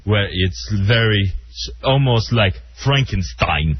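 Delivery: slow, heavy Russian accent. Shown as a demonstration. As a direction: drag it out like Frankenstein in Russian. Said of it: "Russian accent is like this".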